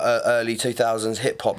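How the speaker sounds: ordinary talk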